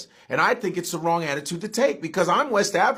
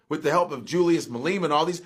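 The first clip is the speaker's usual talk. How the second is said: ordinary delivery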